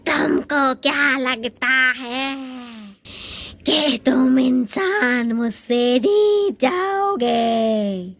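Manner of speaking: slow low mocking witch; witch taunt. Witch mocking the humans, slow low taunt. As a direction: sneer at the humans slowly. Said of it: "चुड़ैल".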